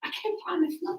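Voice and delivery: unvoiced sly whisper. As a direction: whisper it slyly. Whispered joke reply.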